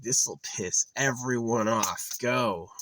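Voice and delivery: slow, high, loud mock creator voice; a smug god dooming everyone; hammed-up creator voice, high and drawn out; voicing the creator. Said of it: "The creator made it, is like".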